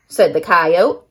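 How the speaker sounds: ordinary delivery, nothing marked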